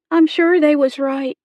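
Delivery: put-on high, loud girlish voice; resigned young girl. A narrator's reading. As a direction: concede it high, in rustic dialect. Girl Becky resigned to insults.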